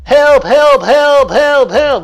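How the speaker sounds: high, loud, repeated yells for help; imitated yelling. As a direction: yell it over and over like the tape. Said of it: "over top of the yelling".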